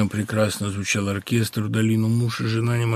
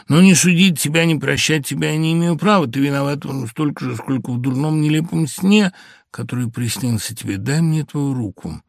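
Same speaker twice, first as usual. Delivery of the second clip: raised, urgent heartfelt plea; emotional plea; 5 semitones higher, 6 dB louder than usual. Acted. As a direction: pour out her heart, voice rising. Heartfelt confession to husband. (взволнованная мольба к мужу)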